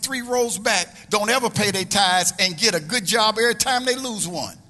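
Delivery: high, loud, driving preacher delivery; preacher driving the point about tithers; preaching at full force. Acted. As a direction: work the room. Preacher building, congregation music.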